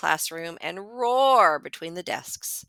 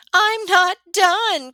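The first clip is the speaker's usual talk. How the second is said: put-on high, loud, slow child's protest; cries out; 13 semitones higher, 9 dB louder, slower than usual. A narrator's reading. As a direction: wail it like a child who won't stop. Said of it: "cries Amy".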